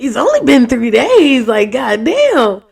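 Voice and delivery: amazed he says I love you after three days, loud and slow; exasperated. Real, not acted. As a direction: stretch it out, dumbfounded. Exasperated emphasis on too soon.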